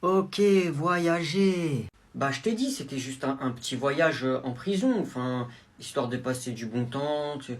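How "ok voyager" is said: drawn-out dry repeat; mocking mother; sarcastic disbelief. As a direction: stretch the word skeptically. Mother unimpressed by excuse.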